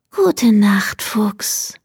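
contented sighing good night; happy sigh. A narrator's reading. Hare answers Fox's good night with a happy sigh. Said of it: "seufzte Hase froh".

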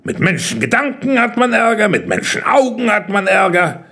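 high, grumbling tirade; irritated, high-pitched grumbling. A narrator's reading. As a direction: list the grievances impatiently. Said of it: "murrte er".

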